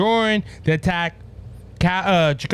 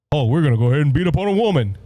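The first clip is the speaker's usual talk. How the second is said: lightly voiced thug, quick and run together; a hint of a swaggering thug, rattled off; mock thug; 3 semitones lower, faster than usual. Host mimicking thugs who attack a woman, mock thug.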